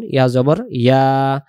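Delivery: ordinary delivery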